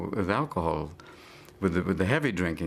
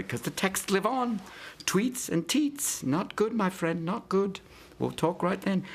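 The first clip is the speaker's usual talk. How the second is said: much higher, slow, camp teasing voice; high mock voice; 11 semitones higher, slower than usual. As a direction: tease him in a squeaky high voice. Mock high voice advising Tiger.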